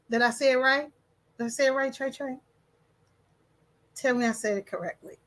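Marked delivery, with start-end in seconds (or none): none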